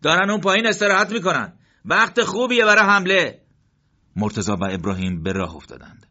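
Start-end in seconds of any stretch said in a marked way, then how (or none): 0.0-3.4 raised, louder voice of a fighter spotting the enemy; urgent, keyed-up soldier; fighter's line. Acted. Soldier telling his comrade the enemy is resting, raised and eager.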